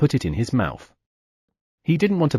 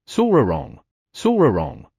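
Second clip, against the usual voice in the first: snoring sounds. Acted. Sleeping minotaurs snoring.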